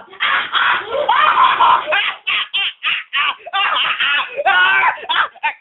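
loud cackling evil laugh. Shown as a demonstration. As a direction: cackle like a cartoon villain. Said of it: "Like this one".

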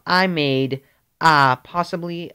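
ordinary delivery

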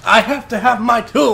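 mocking shout. Mocking friend's words.

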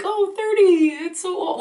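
high, mock-horrified gasp over thirty; mock dismay. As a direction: parody someone shocked by being thirty. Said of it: "couldn't care less about, like".

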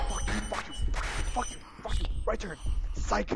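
ordinary talk

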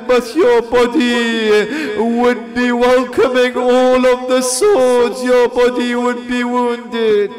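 loud, rising mourning chant of a majlis reciter; loud sung-through lament for the Imam; grieving lament. Acted. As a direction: pour grief into every line, voice climbing. Lament continues, farewell words.

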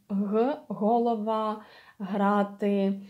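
голова and грати as "holova", "hraty" with voiced h. Shown as a demonstration. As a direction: slowly model г with voice in the exhale. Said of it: "and you use your voice".

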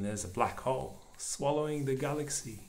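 usual speaking voice

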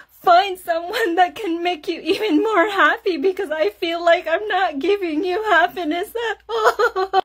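very high, loud mock wailing; hammed-up sobbing. Husband begging his wife to leave him, mock wailing. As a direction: beg through exaggerated bawling and sobs. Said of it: "I saw him crying".

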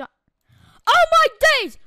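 high, loud cry of disbelief; shocked outburst. Real, not acted. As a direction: exclaim it in loud, drawn-out shock. Startled by many copies.

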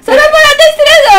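shout. Real, not acted. Partner attacked her in game.

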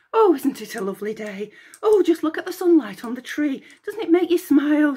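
high, chattering nymph voice; babbling chatter. Acted. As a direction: gush on breathlessly about the day. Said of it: "she babbled".

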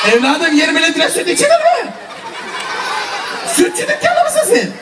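fast, pitch leaping high at the end; mock incredulity. Host teasing a child over twenty litres, squeaky mock disbelief. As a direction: playfully scold in rising disbelief.

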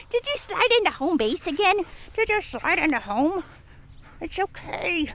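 high-pitched teasing coo; playful doggy talk. Real, not acted. Trainer teasing Daisy after her slide, high and playful. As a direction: coo playfully at your dog.

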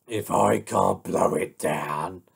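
low, gruff wolf voice; scheming wolf. Acted. Wolf plots against the brick house, low and menacing. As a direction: plot darkly as the big bad wolf.

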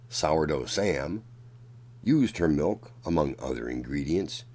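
ordinary delivery, nothing marked

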